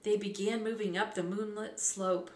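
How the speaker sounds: ordinary delivery, nothing marked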